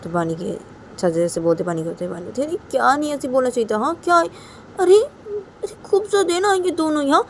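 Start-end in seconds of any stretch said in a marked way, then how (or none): 2.4-7.2 high, loud exclaiming; acted protest. Bani's side answering back in the story, high acted protest. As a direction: protest in a raised, high-pitched voice.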